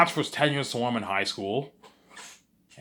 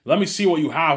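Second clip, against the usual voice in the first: louder, higher firm demand; stern demand; 5 semitones higher, 7 dB louder than usual. Retold or imitated. Teacher demands the hidden paper, firm loud demand. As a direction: insist on seeing it.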